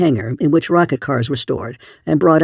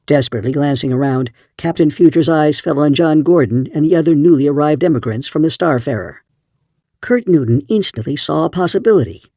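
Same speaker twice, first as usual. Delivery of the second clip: plain reading voice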